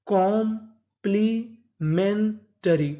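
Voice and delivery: Complementary in four slow chunks, कॉम-प्ली-मेन-टरी; very slow teaching breakdown of complementary; syllable by syllable. Shown as a demonstration. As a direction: break complementary apart with clear pauses. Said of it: "टुकड़ों में तोड़ तोड़ के पढ़ें".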